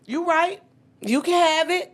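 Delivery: conceding. Retold or imitated. Conceding the point, high.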